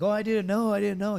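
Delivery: high, loud, panicky protest; mock-panicked, pleading he didn't know; blurting out his innocence in a hammed-up rush; flustered pleading. Scolded, pleading ignorance.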